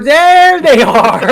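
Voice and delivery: slow, high and loud unveiling of the guests; big reveal. Acted. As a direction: present them like a grand prize.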